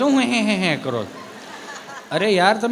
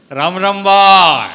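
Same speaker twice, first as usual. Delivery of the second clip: put-on much louder, slow old man's hail; loud slow greeting; 10 dB louder, much slower than usual. Old man greeting shopkeeper.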